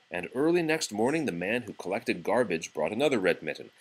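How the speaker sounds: ordinary delivery, nothing marked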